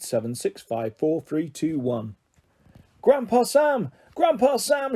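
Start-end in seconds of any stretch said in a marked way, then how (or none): 3.0-4.9 high calls after him; shouting. Acted. Sam shouting after his grandpa from the ladder, calling. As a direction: call out to stop Grandpa walking away. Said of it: "shouted Sam".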